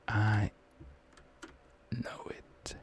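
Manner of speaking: hushed, breathy certainty; a whisper so the enemies won't hear; tense whisper. Real, not acted. Gamer creeping up on waiting enemies, whispered.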